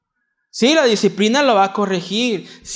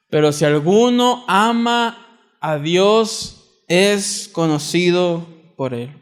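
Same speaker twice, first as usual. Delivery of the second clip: scripture reading; slower than usual. Acted. Preacher reads Corinthians thirteen, building then hushed. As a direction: build the verse then let it fall.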